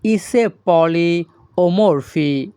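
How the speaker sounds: ইসে পলি ও Murphy said slowly and clearly; slow Greek model phrase; slow demo. Shown as a demonstration. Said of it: "এই বাক্যের Greek ভাষা হবে".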